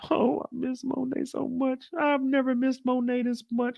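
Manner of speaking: high, whimpering mock sob; mock-weep like a heartbroken man; whiny crying. [name] crying over perfume.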